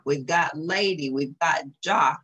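ordinary delivery

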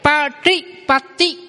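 slow Patipati with two different t sounds; Pali model word. Shown as a demonstration. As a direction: drill Patipati slowly. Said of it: "hai chữ ti này đọc khác nhau".

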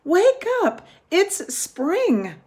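high, loud calling out for Toad; shouting. A narrator's reading. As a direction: shout his name up at the house. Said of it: "shouted Frog".

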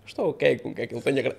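normal talking voice

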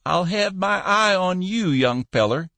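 put-on shrill it like a spry old codger; shrill old man. Old boss warning the clerk, shrill and high. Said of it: "he shrilled".

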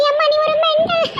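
put-on high giggly girl voice; tease, then burst into a long laugh; giggly taunt. Daughter calling mother mental, giggly taunt.

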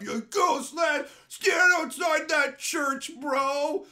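high, loud, slow cheering yell; excited shout. Retold or imitated. Streamer hyping the guitar solo to [name], shouting.

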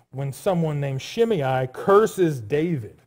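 ordinary delivery

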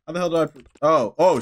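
normal talking voice

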